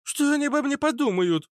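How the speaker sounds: put-on high-pitched girl's outburst; indignant exclamation. A narrator's reading. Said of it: "воскликнула фитилёк". (возмущённо воскликнуть, высоко)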